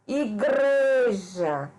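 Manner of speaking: "Igreja" very slow, GRE stretched out; teacher's slow "Igreja", leaning on GRE; stress on GRE. Shown as a demonstration. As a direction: draw out the strong middle syllable GRE in "Igreja". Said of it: "Sílaba mais forte é gre".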